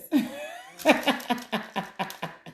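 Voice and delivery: airy high laugh with no voice, falling away; breathy high laugh. Real, not acted. Reacting to a viewer's comment, real laughter. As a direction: burst out laughing at the joke.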